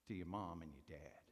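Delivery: normal talking voice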